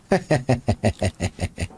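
low staccato chuckle; a run of short, low laughs; chuckling. Real, not acted. Player laughs alone after a question.

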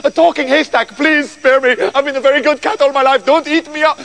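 high, panicky pleading; frightened cat begging for its life; terrified cat. Acted. Terrified cat begs the haystack.

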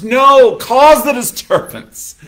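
loud, high, slow emphasis on cause; emphatic rebuttal. Real, not acted. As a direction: throw it back at them with force. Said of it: "preacher's rebuttal".

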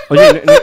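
very high, loud laughing shriek; shrieking laugh into alarm. Real, not acted. Gamer shrieks with laughter near the lava. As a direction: shriek with laughter, then cry out.

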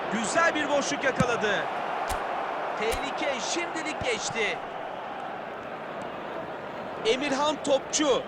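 ordinary talk, nothing marked

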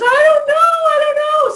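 high, loud tearful wail; crying child. Retold or imitated. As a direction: bawl it out like a hurt little girl. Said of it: "And Simran says".

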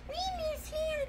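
high, soft whine; crying toy animals. Pretend. Lion and unicorn missing Hannah, high soft pretend cry. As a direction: whimper it in a squeaky pretend voice. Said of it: "Lion and Unicorn go".

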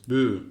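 "Bü" said firmly with rounded ü; bü sound. Shown as a demonstration. Teacher modelling "Bü" loudly for students. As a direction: sound out "Bü" for the class to copy. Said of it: "bü diye okuyacağız".

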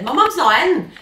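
high, loud retold answer; child calling out. Nine-year-old to man at the door, acted loud call.

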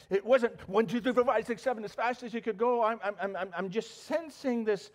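ordinary talk

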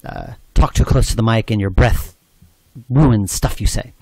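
loud, too close to the mic, popping plosives; breath pops demo. Shown as a demonstration. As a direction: talk right into the mic so breath blasts it. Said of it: "breath pops meaning stuff like this".

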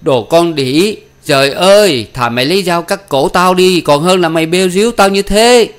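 high, loud, anguished tirade; enraged, wounded father's outburst; furious roar. Acted. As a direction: roar abuse at the daughter. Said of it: "lão còn gầm lên".

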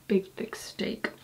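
slow, soft unvoiced whisper. Real, not acted. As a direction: breathe it out slowly in a whisper. Whispering at night by camp.

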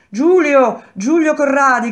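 very high, loud cry of a name; anguished shout. A narrator's reading. Woman calling Giulio in jail, anguished shout. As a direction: cry out for him, near tears. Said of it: "gridò una voce e si sentì il pianto".

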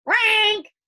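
loud, high bird squawk; startling harsh squawk of a trapped bird; harsh heron croak. A narrator's reading. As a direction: let out a sudden croaking cry, high and loud. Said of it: "a sudden loud, harsh, croaking noise".

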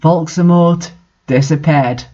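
ordinary delivery

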